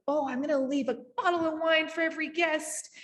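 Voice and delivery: mock naive host. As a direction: poke fun at your own eager plan, voice lifted. Mocking naive host's plan.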